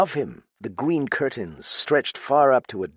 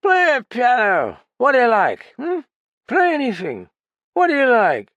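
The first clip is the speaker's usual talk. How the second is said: put-on persuasive drunk; 7 dB louder than usual. Drunk coaxing his guest to stay, high and slurred. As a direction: wheedle him into staying.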